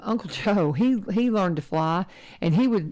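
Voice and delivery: ordinary talk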